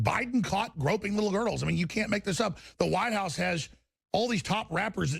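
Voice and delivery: ordinary talk, nothing marked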